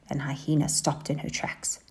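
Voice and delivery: plain reading voice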